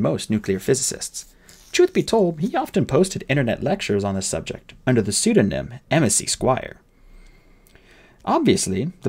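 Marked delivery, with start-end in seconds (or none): none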